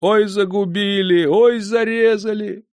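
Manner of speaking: high, drawn-out lament; keening innkeeper's cry, stretched and very high; loud wailing. A narrator's reading. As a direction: wail it out to the whole house. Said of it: "заголосил на всю избу".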